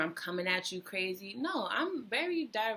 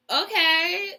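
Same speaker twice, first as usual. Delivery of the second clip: usual speaking voice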